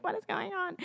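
comic, squeaky disbelief; mock bewilderment. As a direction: replay your baffled reaction up high. Acts out shock at interrupting ad.

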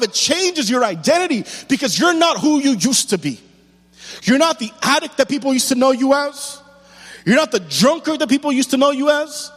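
loud, high, ringing sermon delivery; full-force preaching. Acted. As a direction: preach it out to the whole church, rising hard.

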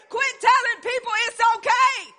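loud, high rebuke from the pulpit; preaching shout. Real, not acted. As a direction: hammer it home to the church.

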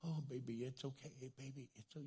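soft, soothing murmur; comforting parent. Acted. Parent picks up a crying little kid, soft comforting. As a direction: hush a hurt child tenderly. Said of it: "you're, you're comforting".

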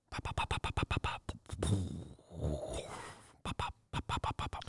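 soft whispered pop pop imitation; whispered gunfire pops. Host imitating distant gunfire in the movie sound, whispered. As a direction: breathe the pops like faraway shots.

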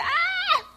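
high-pitched mock snake scream; shrill cartoonish yelp imitating a snake; hollering snake. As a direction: let out a sudden high holler. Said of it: "It hollered".

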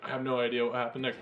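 ordinary talk, nothing marked

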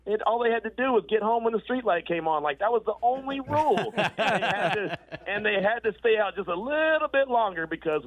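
high, laughing through the words; laughing joke. Real, not acted. Host jokes his team missed curfew, laughing high.

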